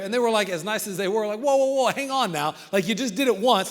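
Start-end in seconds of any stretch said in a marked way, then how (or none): 1.4-3.7 louder, higher "hold on" plea; alarmed protest. Acted. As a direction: halt him urgently, voice raised. Crowd protests a second attempt.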